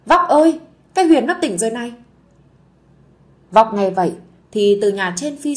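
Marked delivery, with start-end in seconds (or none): 0.1-1.9 higher, louder call, then a fast rush; excited yell. Acted. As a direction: shout across the yard with the good news. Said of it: "chạy ra ngoài sân hét toáng".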